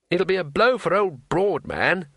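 lightly voiced high, earnest tourist; naive tourist. Tourist pitying the innkeeper, innocently concerned.

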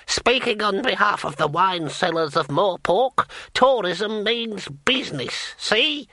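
put-on high, cackling old man's voice. A narrator's reading. Old bearded man cackling over the guildmaster's shoulder. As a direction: crow it like a gleeful old codger.

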